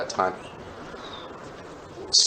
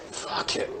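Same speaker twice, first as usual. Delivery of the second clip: hushed give-up; 9 dB quieter than usual. Retold or imitated. Giving up.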